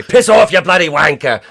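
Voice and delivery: loud, high mock-British insult; hammed-up British accent at full volume; heavy British accent. Shown as a demonstration. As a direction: yell it like an over-the-top angry Brit. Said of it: "a heavy British accent".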